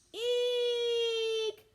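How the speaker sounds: high shrill-free squeal of fright, loud and high; girls' scream. A narrator's reading. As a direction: shriek in fright at the snake. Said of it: "screamed all the girls".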